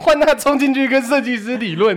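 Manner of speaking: high, loud, laughing through the words. Real, not acted. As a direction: tell it while cracking up. Laughing while telling climax. (边笑边讲)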